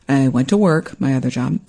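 normal talking voice

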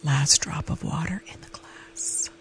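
slow breathy whisper of the breaking point; whispered, slow and ominous; dramatic whisper. Acted. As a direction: hush it like a confession. Dramatic aside.

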